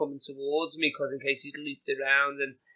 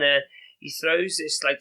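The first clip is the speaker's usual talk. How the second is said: usual speaking voice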